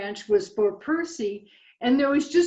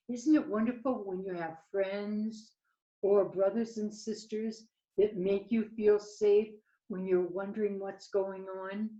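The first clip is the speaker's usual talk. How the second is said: ordinary delivery, nothing marked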